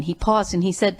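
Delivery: ordinary talk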